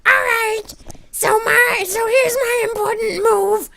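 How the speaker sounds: high, loud, drawn-out cartoon voice; Spoons. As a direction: proclaim it shrieky and slow, in character. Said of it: "And Spoons goes".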